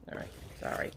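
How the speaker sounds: breathy whispered apology; whispered aside. Real, not acted. Apologizing off camera, a soft whispered sorry. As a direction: whisper it off to the side. Aside after moving box.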